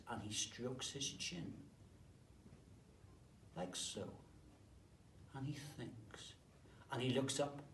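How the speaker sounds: usual speaking voice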